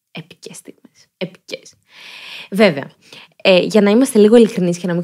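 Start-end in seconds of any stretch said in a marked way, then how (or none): 0.1-1.7 whispered aside, barely there; hushed, voiceless reaction; awed whisper. Real, not acted. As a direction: whisper it in mock reverence.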